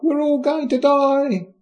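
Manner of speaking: very high, drawn-out mock wail; hammed-up hysterical shriek, held high and slow; mock panicked scream. Mary's ladies running around the court, mock panicked screaming. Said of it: "running around screaming stuff like".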